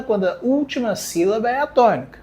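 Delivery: ordinary talk, nothing marked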